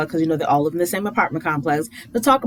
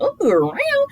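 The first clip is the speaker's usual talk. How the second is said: impressed ooh. Real, not acted. Her reaction to McKinley's jab.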